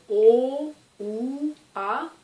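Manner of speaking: "O", "ou", "A" held long and open, one by one; drawn-out vowels. Shown as a demonstration. Teacher showing learners what vowels are, "O", "ou", "A" drawn out. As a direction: stretch each vowel "O", "ou", "A" by itself. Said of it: "les prononcer en les allongeant".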